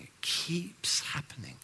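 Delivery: whispered repeat of "It keeps happening.". Shown as a demonstration. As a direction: breathe "It keeps happening." unvoiced and slow.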